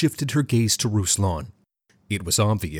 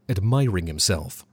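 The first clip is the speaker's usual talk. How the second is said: plain reading voice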